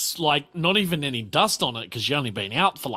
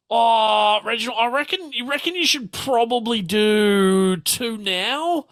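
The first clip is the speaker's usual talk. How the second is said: higher lilting lady's voice; character voice; 7 semitones higher than usual. Acted. Telling Reginald what to give, higher coaxing voice. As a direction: coax her husband in a higher voice. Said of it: "She's like".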